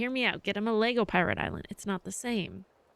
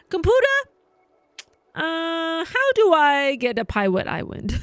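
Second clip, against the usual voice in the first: louder, higher voice-assistant command; commanding the computer; 7 semitones higher, 5 dB louder than usual. Pretend. Laughing, she orders her computer about a pirate island. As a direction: bark the order at the computer like a gadget.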